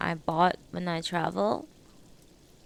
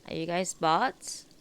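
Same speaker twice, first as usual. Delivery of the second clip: usual speaking voice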